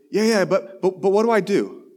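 put-on a keen kid asking his pastor what to do, eager and insistent; eager young student. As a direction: pester the pastor like an anxious kid. Luke pressing for steps.

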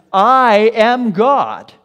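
loud, high, very drawn-out words; divine declaration. Retold or imitated. Preacher voicing God to Moses, declaring. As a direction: declare it with great slow weight. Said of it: "the first thing he says is".

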